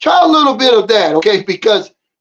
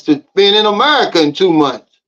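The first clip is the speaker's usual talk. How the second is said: ordinary delivery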